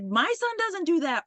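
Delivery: high-pitched protesting parent; mock heckling. Parent challenging the doctor on stage, mock heckling. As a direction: imitate an indignant parent objecting from the audience. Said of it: "these parents are pretty much heckling this guy".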